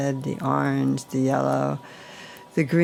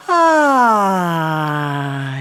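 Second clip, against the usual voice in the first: deep sighing ah; 5 dB louder than usual. Shown as a demonstration. Guide demonstrates the releasing sigh, a loud open ah. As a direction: let a full ah pour out. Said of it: "So that deep sigh".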